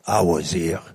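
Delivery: put-on low, lightly French-accented. Lightly French-accented, quoting his dying friend. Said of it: "I said it in a weird French accent".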